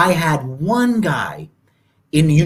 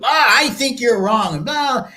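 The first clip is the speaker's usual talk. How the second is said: high-pitched mock arguing; brash youth; 9 semitones higher than usual. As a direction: imitate young people forcing their opinions. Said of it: "when you're young, you".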